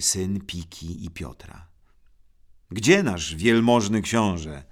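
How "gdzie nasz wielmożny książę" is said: louder, a mocking call from the doorway. A narrator's reading. Younger brother calls from the doorway, mocking and loud. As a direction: call it out across the room. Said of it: "zawołał od progu".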